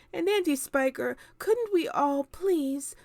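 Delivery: put-on a lonely child begging; James pleading. A narrator's reading. James begging his aunts, high and pleading. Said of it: "James cried out".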